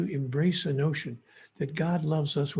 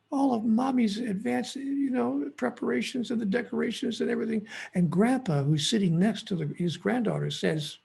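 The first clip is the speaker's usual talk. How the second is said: normal talking voice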